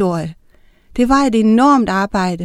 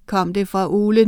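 usual speaking voice

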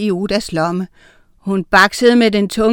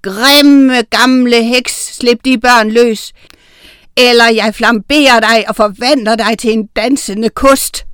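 roared warning, raised and forceful; dragon-like roar; 2 semitones higher, 7 dB louder than usual. A narrator's reading. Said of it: "Han brølede højt".

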